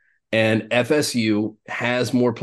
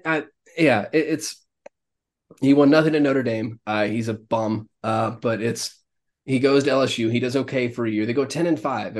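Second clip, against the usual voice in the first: normal talking voice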